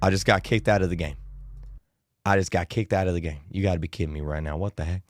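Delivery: low, quick, quiet grumble; real annoyed aside. Real, not acted. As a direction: mutter it fast under your breath. Muttered aside about being kicked.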